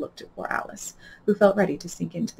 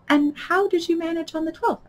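eager; 11 semitones higher than usual. Acted. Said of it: "Alice went on eagerly".

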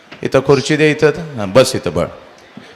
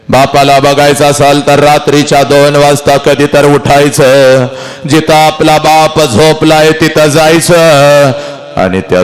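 impassioned preaching; 3 dB louder than usual. Acted. Orator pleading with daughters about their fathers, impassioned preaching.

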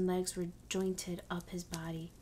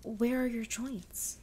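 mildly anxious, slow and higher question; understated anxiety, drawn out and raised in pitch; 4 semitones higher, slower than usual. A narrator's reading. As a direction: ask slowly with a touch of worry. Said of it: "asked anx-, anxiously".

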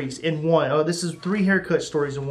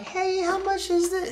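small, high, softer voice; timid asking; 12 semitones higher, 6 dB quieter than usual. Retold or imitated. Customer asking scary barber the price, timid asking. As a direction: ask meekly, pitched way up.

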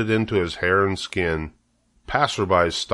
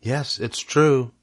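sigh; 5 semitones higher, slower than usual. Acted. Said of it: "sighed Charlotte".

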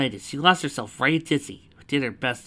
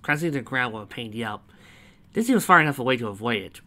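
ordinary delivery

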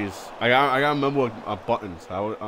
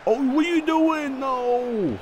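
very high, drawn-out squeal of protest; exasperated wail; 14 semitones higher, slower than usual. Real, not acted. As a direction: wail in dismay at your own player. Player's move goes wrong mid-game.

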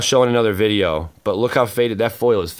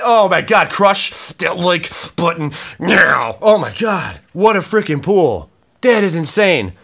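usual speaking voice

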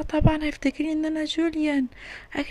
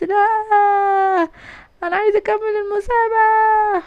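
higher, louder desperate cry; girl begging the judge loudly to stay in the contest; desperate plea; 8 semitones higher, 7 dB louder, much slower than usual. Acted. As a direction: beg them not to drop you.

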